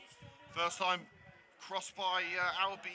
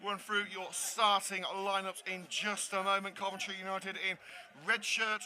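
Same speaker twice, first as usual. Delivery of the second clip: upbeat, fast broadcast intro; match announcer; 6 semitones higher, 5 dB louder than usual. Acted. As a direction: welcome viewers like a TV sports host.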